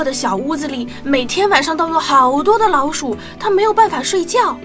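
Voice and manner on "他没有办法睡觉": ordinary delivery, nothing marked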